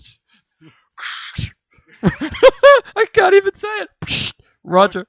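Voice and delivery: breaking into high giggles mid-word; trying to talk through helpless laughter; giggling. Real, not acted. Co-host cracking up trying to say Roger, giggling.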